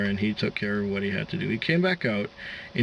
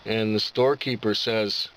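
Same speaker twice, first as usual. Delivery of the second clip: usual speaking voice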